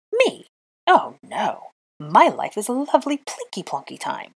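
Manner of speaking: high, loud mock-cheerful protest; shrieky sarcasm. A narrator's reading. Witch rants about her lovely life, shrieky sarcasm. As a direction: swoop up high in outraged sarcasm. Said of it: "Granger's shriekiness increased".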